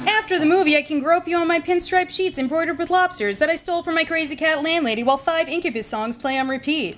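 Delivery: ordinary talk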